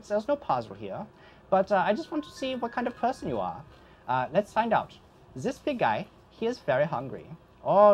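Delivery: put-on lilting accented host voice, pitch swooping up; theatrical ghost host, sly and courteous; ghostly host Alvin. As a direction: play a ghostly host welcoming a guest to a deadly game. Game master voices parlor host.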